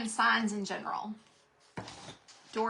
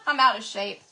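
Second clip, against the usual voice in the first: laughing; 2 semitones higher, 10 dB louder than usual. Real, not acted. Laughing at herself.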